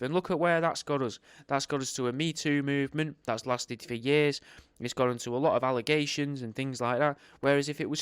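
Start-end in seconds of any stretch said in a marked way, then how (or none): none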